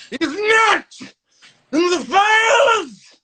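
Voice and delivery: high, loud, slow furious scream; screaming outburst. Retold or imitated. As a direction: explode on every word. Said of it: "And he goes".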